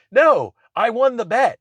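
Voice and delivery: high, slow mock-smug gloat; gloating friend. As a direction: lightly mimic a smug winner. Friend gloating over a bet.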